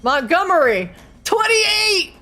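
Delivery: high, loud excited shout; excited callout. Real, not acted. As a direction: call out the rolls in triumph. GM calling rolls excitedly.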